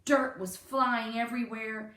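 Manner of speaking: ordinary delivery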